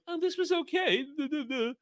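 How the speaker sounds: high-pitched mocking dopey voice; mock feigned interest. Streamer mimics shills covering the real thing, mock half-interest. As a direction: put on a silly, high, half-hearted review voice. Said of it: "they're so enthused, they're so excited".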